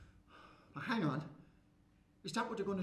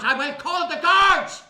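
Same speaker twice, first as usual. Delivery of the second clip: high, loud, slow threat; shouted threat; 9 semitones higher, 15 dB louder, slower than usual. Acted. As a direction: threaten to call the guards. Shopkeeper threatening.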